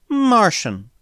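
high, falling note of disdain; scornful. A narrator's reading. Proud alien girl rejects Smith's guess, scornfully. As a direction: throw the word back with scorn. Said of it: "she said scornfully".

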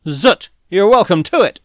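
disgusted. A narrator's reading. Drunk drylander gives up the girl to Smith, disgusted. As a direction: spit it out and be done.

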